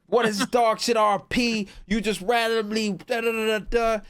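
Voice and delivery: high mocking whine of a sore loser; mock yell. A downed player complains about the robbery, high and whiny. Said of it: "was yelling".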